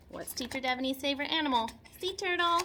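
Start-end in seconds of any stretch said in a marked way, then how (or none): 2.0-2.6 gleeful answer. Real, not acted. Answers her own quiz gleefully.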